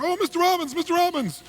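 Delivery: high, eager call of the name; calling out excitedly. Retold or imitated. Waiter spots a favourite guest leaving, calling out excitedly. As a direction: catch his attention before he leaves.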